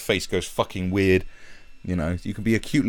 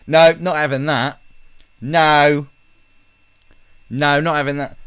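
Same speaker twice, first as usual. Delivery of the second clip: louder, higher mock protest; mock outright refusal; 7 semitones higher, 6 dB louder than usual. Fans rejecting a pop star's haircut, loud mock refusal. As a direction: refuse loudly as the outraged fans.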